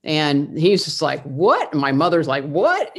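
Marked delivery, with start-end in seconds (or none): 1.2-1.7 higher, louder astonished "What?"; stunned doctor. Retold or imitated. Doctor watching the girl walk without crutches, surprised. 2.4-3.0 much higher, rising "What?"; astonished mother. Retold or imitated. Mother seeing her daughter walk across the room, shocked.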